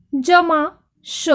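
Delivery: normal talking voice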